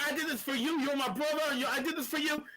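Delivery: high pleading mock voice; imitating Jimmy. Retelling Jimmy answering Roman, high earnest plea. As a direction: pitch it up like a brother justifying himself. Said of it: "And Jimmy's like".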